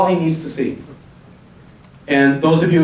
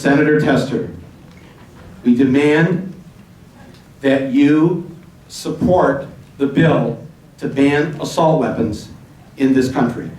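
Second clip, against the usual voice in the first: slow phrases with deliberate pauses between; measured, word-for-word dictation; slower than usual. Shown as a demonstration. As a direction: dictate it chunk by chunk for people typing. Dictating message for audience to type.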